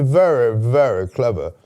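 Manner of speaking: posh voice. Retold or imitated. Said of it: "It's very posh".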